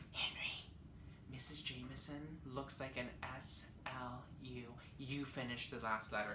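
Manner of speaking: breathy hushed whisper into a friend's ear. Retold or imitated. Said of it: "I whisper in his little ear".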